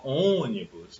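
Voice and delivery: ônibus with a held, weighted first O; stress on first syllable. Shown as a demonstration. Teacher showing the tonic syllable, stress on Ô of ônibus. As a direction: lean on the Ô of ônibus. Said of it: "A sílaba tônica é o O".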